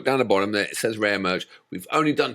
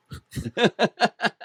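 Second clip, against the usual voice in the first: high, breathy laugh at his own joke; burst of laughter; 12 semitones higher than usual. Real, not acted. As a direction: crack up laughing.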